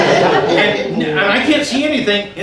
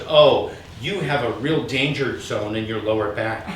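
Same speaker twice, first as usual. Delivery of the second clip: low soft healer voice; 10 semitones lower, 5 dB quieter than usual. Retold or imitated.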